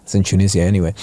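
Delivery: a mild put-on French accent. Saleswoman tells visitors where she is from, imitated French accent. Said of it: "she had a French accent".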